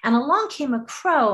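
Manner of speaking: ordinary talk, nothing marked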